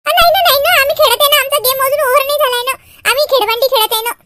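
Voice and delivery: put-on high, hurried little-girl protest; protesting child. Girl says the game is not over, protesting. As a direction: object quickly, pleading to keep playing.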